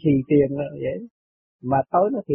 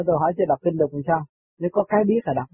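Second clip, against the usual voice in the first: usual speaking voice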